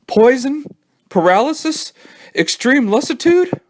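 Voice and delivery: high, panicked racing thoughts; panicky. A narrator's reading. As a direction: voice it in rising panic.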